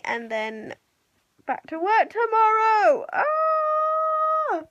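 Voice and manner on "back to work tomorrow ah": mock dread. Real, not acted. Dreading work tomorrow.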